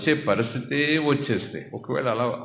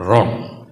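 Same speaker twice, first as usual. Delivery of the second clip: one loud blunt word; emphatic wrong; 3 semitones lower, 7 dB louder, slower than usual. Real, not acted. Preacher rejecting the congregation's motives, loud and blunt. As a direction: slam down the verdict.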